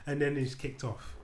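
ordinary talk, nothing marked

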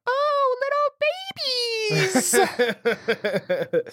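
put-on high, slow baby-talk coo; cooing at babies. Worker cooing at college runners, high baby talk. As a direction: coo it like to an infant. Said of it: "I don't know why I said this".

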